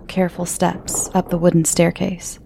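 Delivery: plain reading voice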